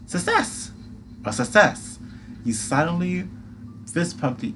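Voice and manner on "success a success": high, bright cheer; excited squeal. Acted. Celebrating the photo.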